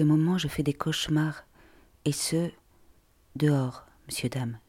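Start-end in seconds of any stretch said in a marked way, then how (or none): none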